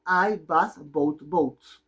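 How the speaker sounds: very slow, calmer model sentence; slow repeat. Shown as a demonstration. Teacher repeating the example sentence very slowly. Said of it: "¿Cómo se pronuncia esa oración?".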